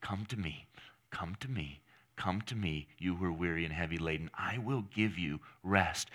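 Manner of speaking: soft, low, tender invitation; gently relentless. Acted. Preacher voicing the Savior calling the weary, tender persistence. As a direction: repeat it as a quiet, patient plea. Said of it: "which says relentlessly".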